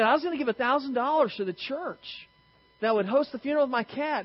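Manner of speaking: high, exasperated plea. Retold or imitated. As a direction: imitate a fed-up caller, pitched well up. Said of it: "finally she's just exasperated".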